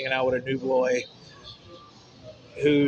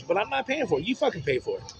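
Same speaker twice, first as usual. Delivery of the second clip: usual speaking voice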